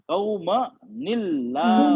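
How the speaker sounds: कौमनिल्लाहु stretched slowly, thin Allah; slow recitation. Shown as a demonstration. Teacher models the joined reading for class. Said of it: "नून के साथ जेर लगा के".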